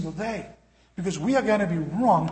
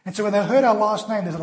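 ordinary talk, nothing marked

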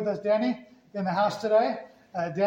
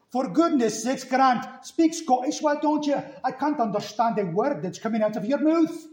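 higher-pitched, exasperated Scottish scolding; Scottish accent; 5 semitones higher than usual. Retold or imitated. Older sister scolds brother for not speaking Scottish, exasperated Scottish accent. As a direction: imitate a Scottish sister telling off her brother. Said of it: "Grant's parents were from Scotland".

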